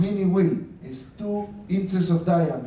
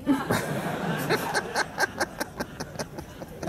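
breathy laugh; 11 semitones higher, 5 dB quieter than usual. Real, not acted. Laughing at own joke.

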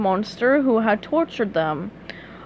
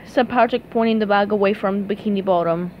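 ordinary delivery

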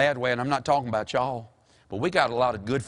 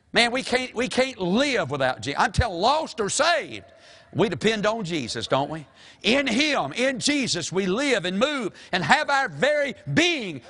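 high-pitched, driving sermon cadence; full-force preaching; 6 semitones higher than usual. Real, not acted. Preacher urging dependence on Jesus, full force. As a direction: press each phrase high and urgently.

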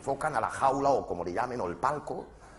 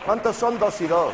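slow, loud, higher talking-down question; slow mock quiz; 8 semitones higher, 6 dB louder than usual. Comedian quizzing a dim footballer, slow and condescending. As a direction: ask it slowly like to a child.

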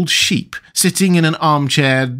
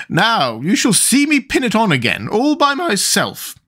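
high, proud crowing; triumphant; 9 semitones higher than usual. A narrator's reading. Queen pinning her shawl on by herself, triumphant. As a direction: crow it, stretching out the last words. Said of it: "in a triumphant tone".

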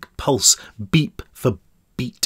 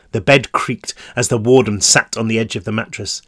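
plain reading voice

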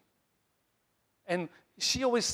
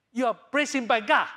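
loud proclamation; 5 semitones higher, 8 dB louder than usual. Real, not acted. Pastor proclaims to congregation.

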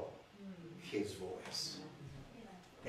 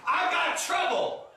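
high, loud comic wail; mock cry of trouble, pitched high; modern-day complaint; 13 semitones higher, 4 dB louder than usual. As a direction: shout it as a hammed-up outburst. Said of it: "we don't use it in modern vernacular".

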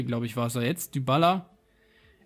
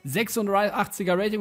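excited; 6 semitones higher than usual. Real, not acted. Cheering a pulled player card.

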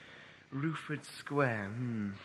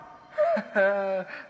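short laugh; 6 semitones higher, 8 dB louder than usual. Acted. Laughs after yelp.

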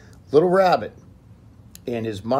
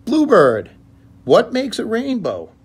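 high, loud little bunny call; Little Rabbit calling; 13 semitones higher, 13 dB louder than usual. Acted. Little Rabbit calls to Bluebird.

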